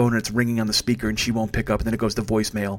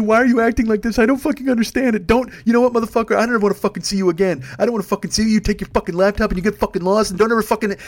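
put-on sobbing angry girlfriend; 8 semitones higher than usual. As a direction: voice her heartbroken rage on voicemail. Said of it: "it's a sobbing woman".

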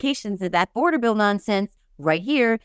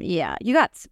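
ordinary delivery

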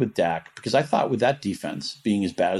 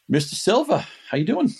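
high, bright hello to a guest; excited greeting; 9 semitones higher than usual. Real, not acted. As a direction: greet him with a lift.

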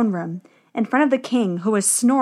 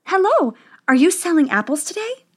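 put-on higher, cheerful young princess voice; 7 semitones higher than usual. A narrator's reading. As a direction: greet the apple seller with eager sweetness.

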